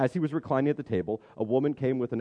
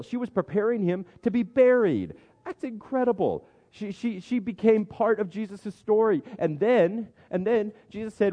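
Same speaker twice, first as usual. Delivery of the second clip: much higher pitch, marveling; amazed excitement; 9 semitones higher than usual. Real, not acted. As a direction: preach it like a thrilling discovery. Amazed at discovery in text.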